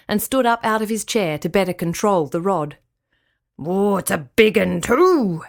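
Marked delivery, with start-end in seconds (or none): 3.6-5.4 put-on slow, gruff old man calling out; lightly voiced old grandad, thrilled at a bite; Grandad excited. A narrator's reading. Grandad hooking a big fish, calling out slowly. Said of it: "he cried".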